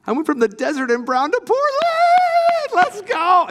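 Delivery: preacher getting carried away praising Portland; real excitement. Real, not acted. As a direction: cheer it out like you can't contain yourself. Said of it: "I'm just excited".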